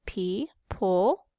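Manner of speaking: "pe-pol" in two separate syllables; split into syllables. Shown as a demonstration. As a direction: break "pe-pol" apart with a pause between the syllables. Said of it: "we have two syllables there".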